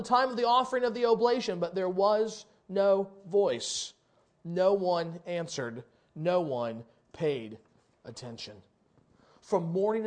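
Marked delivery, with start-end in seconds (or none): 1.6-8.5 slow, quiet, falling refrain; slow solemn refrain. Acted. Preacher dropping to a hush on silence of Baal. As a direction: let each line land heavy and soft.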